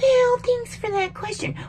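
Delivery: high, prissy parody of an evasive politician; mock politician dodge. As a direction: mimic a slick non-answer. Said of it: "we don't want".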